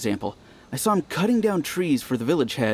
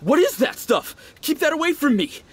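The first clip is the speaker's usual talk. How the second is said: retold shout; 11 semitones higher than usual. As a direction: push the food away with your voice. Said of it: "He shouted".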